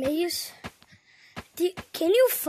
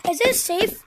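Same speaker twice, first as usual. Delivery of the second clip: normal talking voice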